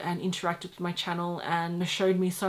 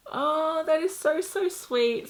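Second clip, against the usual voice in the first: gushing; 9 semitones higher, slower than usual. Real, not acted. Gushing over card.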